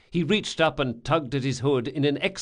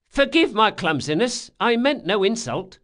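high, loud, earnest apology; apologetic boy; 10 semitones higher, 5 dB louder than usual. Acted. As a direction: plead clumsiness in a high voice. Boy keeps apologising to initiate.

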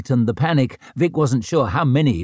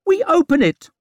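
high, loud, pressing order; urgent high voice; 4 dB louder than usual. Acted. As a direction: push the plan in a high voice. Gorst urging in the fight.

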